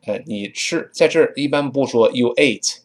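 ordinary talk, nothing marked

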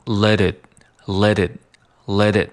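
"let it" linked with a flapped t; flapped linking. Shown as a demonstration. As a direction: flick the t from "let" into "it". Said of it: "仍然是一个跳舌音的一个技巧". (跳舌音连读)

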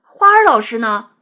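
loud questioning. A narrator's reading. Anxious pupil presses the art teacher about the missing teacher, loudly. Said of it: "大声追问".

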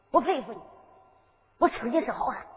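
ordinary delivery

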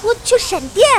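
high, loud startled exclamation; high, loud cry of surprise at the lightning. Acted. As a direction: jump at the lightning.

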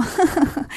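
short, knowing story-character laugh; amused chuckle. Acted. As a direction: chuckle at someone who claims to be old. Monkey laughs at old man's age.

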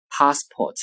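"passport" with a long back "ah" and no final r, British style; British pronunciation. Shown as a demonstration. Teacher giving the British "passport" before the American. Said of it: "英式英语发音passport".

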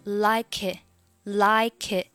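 "like it" slowly joined as "lai-kit"; linked reading. Shown as a demonstration. Teacher showing the link, "like it" as one word. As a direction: slide the k of "like" onto "it". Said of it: "like it连读".

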